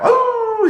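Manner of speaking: high, drawn-out hound howl; a long mournful howl; imitated dog howl. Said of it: "his dog would howl".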